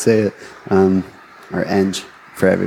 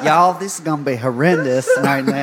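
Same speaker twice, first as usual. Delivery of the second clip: put-on Southern accent, half laughing; Southern US drawl; 3 semitones higher than usual. Shown as a demonstration. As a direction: ham up a Southern drawl through giggles. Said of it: "a really thick Southern drawl".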